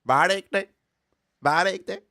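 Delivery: high, loud mock Jamaican meme voice; Jamaican accent. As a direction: shout it in a put-on Jamaican accent. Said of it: "All my accents morph into Jamaican".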